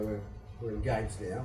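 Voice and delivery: low, soft creepy announcement to a stranger. Pretend. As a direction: murmur it like a creep following someone. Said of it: "didn't just follow someone and go".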